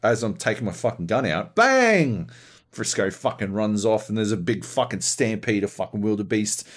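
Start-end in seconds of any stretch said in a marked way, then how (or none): 1.6-2.3 high, loud "bang"; explosive and high-pitched; sudden imitated gunshot. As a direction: burst out with the shot. Imitates the gunshot in his story.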